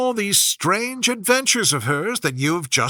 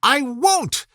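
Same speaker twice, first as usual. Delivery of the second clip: defiant; 9 semitones higher than usual. A narrator's reading. Alice defying the queen.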